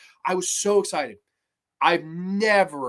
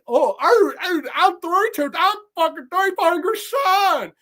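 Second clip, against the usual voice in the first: mock dim kid; 9 semitones higher, 4 dB louder than usual. Mocking the cannibal's kid.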